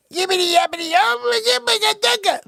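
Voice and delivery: very high gibberish yell; gibberish squeal. Acted. Kid squealing nonsense coming down the slide.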